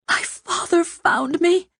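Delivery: whispered in terror. A narrator's reading. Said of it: "she whispered in terror".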